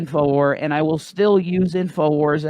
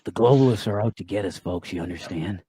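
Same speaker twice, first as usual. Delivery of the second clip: low, fast, put-on host voice; lightly mocking the conspiracy host; Alex Jones; 7 semitones lower than usual. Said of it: "My favorite Alex Jones voice".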